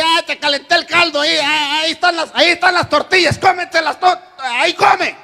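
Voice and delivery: high, loud, fast and begrudging; grudging wife. Retold or imitated. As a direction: shove the food at him unwillingly. Grudging wife serving angry husband. (esposa sirviendo el caldo de mala gana)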